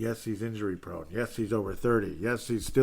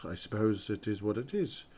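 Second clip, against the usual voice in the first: usual speaking voice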